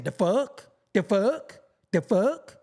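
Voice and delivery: mocking question, voice rising at the end; imitating girls' slang. Man mocking other men for talking like girls, mimicked rising slang. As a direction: parrot it with an upward lilt. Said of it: "I knew girls who be like".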